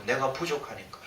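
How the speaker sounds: quiet repeat. Real, not acted. Pastor repeating his confession to himself, softly. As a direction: murmur it back to yourself.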